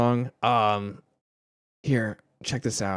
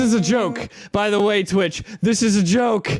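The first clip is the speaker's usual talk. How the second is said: high, loud call to Twitch; loud disclaimer; 9 semitones higher, 5 dB louder than usual. Real, not acted. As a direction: shout it out as a disclaimer. Calls out to Twitch.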